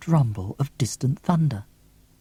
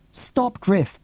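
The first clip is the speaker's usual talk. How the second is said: mild cry out; 8 semitones higher than usual. A narrator's reading. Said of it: "I cry".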